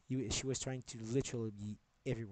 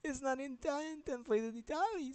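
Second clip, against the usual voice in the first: excited high; 13 semitones higher than usual. Real, not acted. Excited realisation mid-rant.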